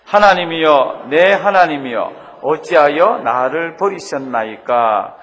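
loud, slow recitation; loud slow scripture reading; reading aloud loudly. Shown as a demonstration. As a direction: read it out loud and slow together. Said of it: "우리 큰 소리로 한번 읽어보시겠습니다".

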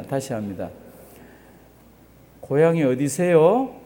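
ordinary delivery

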